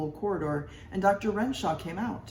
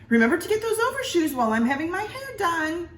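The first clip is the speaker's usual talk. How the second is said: much higher, louder bossy wife; 14 semitones higher, 6 dB louder than usual. A narrator's reading. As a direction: order him about like a busy wife. Wife giving orders.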